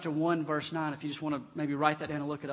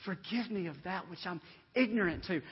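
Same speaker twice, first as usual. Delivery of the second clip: normal talking voice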